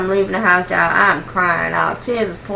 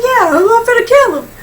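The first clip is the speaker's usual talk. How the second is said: much higher and louder, almost squealing; high, worked-up threat; 14 semitones higher, 6 dB louder than usual. Retold or imitated. Telling her dad she's going to kill him, high and worked up. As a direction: spit out the threat all wound up. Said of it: "I'm like".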